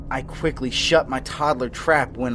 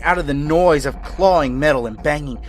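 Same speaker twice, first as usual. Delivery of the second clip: louder and higher, rushing panic; frantic; 4 semitones higher, 8 dB louder than usual. A narrator's reading. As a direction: build the terror as you tell it. Frantic escape builds in intensity.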